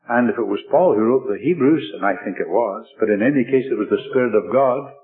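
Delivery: usual speaking voice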